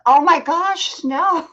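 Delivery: high, loud oh my gosh; surprised. Real, not acted. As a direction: blurt it out in surprise. Reacting to a chat comment.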